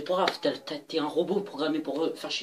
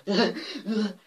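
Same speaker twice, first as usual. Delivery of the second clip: loud mock laugh in a put-on voice; imitating his laugh; 6 semitones higher, 7 dB louder than usual. Kid showing how Victor laughs, loud mock laugh. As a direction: copy the classmate's silly laugh. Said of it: "il rigole comme ça".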